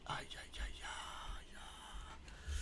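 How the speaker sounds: whispered ay ay ay, cringing; whispered dismay. Real, not acted. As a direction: breathe out the ay ay ay in pure cringe. Cringing at the message.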